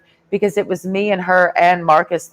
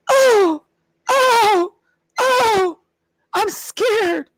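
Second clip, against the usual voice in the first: much higher, whimpering fake fright; mock scared; much slower than usual. Mocking nervous Marines, high whimpering fake fear. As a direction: squeal like a frightened prissy. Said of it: "act so nervous".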